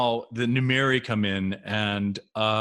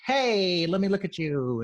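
mock gazing greeting; 8 semitones higher than usual. Actor mocking the look before the beam out, playfully high. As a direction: stretch it out high, poking fun.

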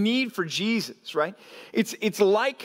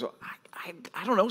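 near-whispered, stammering reply; sheepish hesitation; 5 dB quieter than usual. Retold or imitated. Smalls answers his friends about the name, whispered and hesitant. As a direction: start barely audible, feeling for the words.

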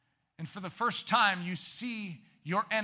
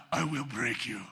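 a lightly voiced movie villain threat; half-hearted Russian boxer menace; Ivan Drago line; 4 semitones lower than usual. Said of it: "The Ivan Drago".

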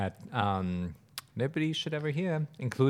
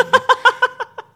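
high burst of laughter; 12 dB louder than usual. Real, not acted. Laughing at yawn.

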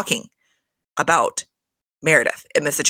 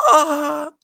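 outraged cry; 10 semitones higher than usual. Real, not acted. Reacting to Mary's comment with a shocked cry.

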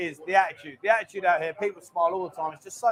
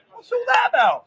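mock indignant squeal; 14 semitones higher, 5 dB louder than usual. Mock indignation after joke.

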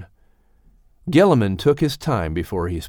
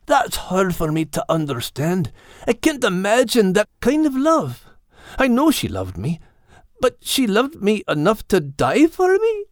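put-on high young puppy voice, higher than the narrator; a frightened young pup remembering his mum, pitched up high; Max the puppy; 10 semitones higher than usual. Puppy Max tells old Gilliman about losing his mum, high and young.

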